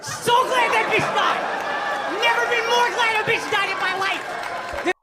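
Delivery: rising to a laughing shout; laughing rant. Real, not acted. Laughing at the Queen's death, a gleeful shout.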